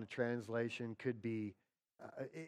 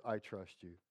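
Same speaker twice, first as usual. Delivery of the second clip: lightly acted, low and soft surrender; understated resigned trust; 5 semitones lower, 6 dB quieter than usual. Preacher voicing disappointed Martha to Jesus, quietly resigned. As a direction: drop low and quiet, as if giving in.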